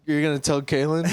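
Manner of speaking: ordinary talk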